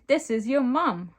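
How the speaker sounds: high, loud announcement in character; acted scream. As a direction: shout it out as a story character. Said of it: "Michael screamed".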